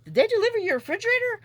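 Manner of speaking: mimicking neighbor Jim, fast and high; neighbor asking. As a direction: rattle off the neighbor's question up high. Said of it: "and he's like".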